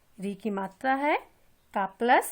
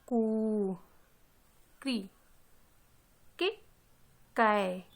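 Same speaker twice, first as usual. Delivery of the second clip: slow, paced chant of each syllable; teacher leading a slow syllable drill; reciting the barakhadi; slower than usual. Shown as a demonstration. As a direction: read out क to कहा for the class. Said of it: "इसको हम एक बार फिर से पढ़ते हैं".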